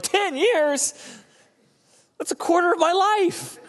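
very high, incredulous squeak; outraged human. Retold or imitated. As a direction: protest in disbelief at the wait. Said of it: "I'm like".

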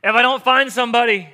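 high, loud mock-desperate wail; desperate pleading. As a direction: beg like you can't be alone tonight. Acted desperate lonely man pleading.

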